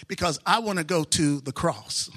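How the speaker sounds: usual speaking voice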